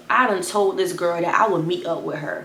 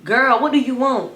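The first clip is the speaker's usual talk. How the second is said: higher, louder, fed up; annoyed phone answer; 5 semitones higher, 4 dB louder than usual. Retold or imitated. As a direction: pick up and snap. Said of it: "I'm like".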